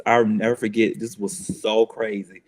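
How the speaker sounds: ordinary talk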